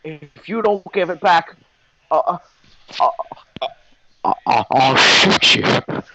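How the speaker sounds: hammed-up threat, stuck on the stutter; put-on threatening voice. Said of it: "he's like".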